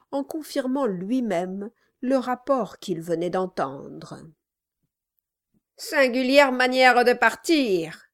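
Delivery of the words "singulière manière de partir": loud, gruff dwarf. A narrator's reading. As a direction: bark it like a surly dwarf. The dwarf's voiced lines.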